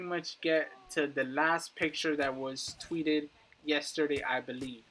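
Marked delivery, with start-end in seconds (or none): none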